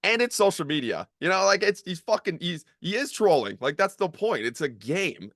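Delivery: high-pitched, incredulous rant; disbelief. Retold or imitated. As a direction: retell an exasperated defense of someone. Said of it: "I was just in disbelief".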